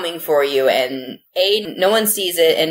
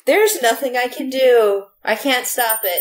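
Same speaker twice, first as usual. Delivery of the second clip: mock hopeless whine; 7 semitones higher than usual. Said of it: "you're just gonna give up".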